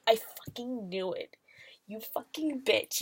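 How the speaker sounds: whispered, gloating under the breath; mock smug whisper. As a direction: hiss it like a smug cop who caught him. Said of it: "almost smug in his".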